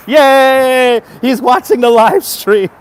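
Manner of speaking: high, loud, thrilled cheer; real happy whoop, dragged out slow; excited cheer. Real, not acted. Streamer cheering for a viewer who joined, excited.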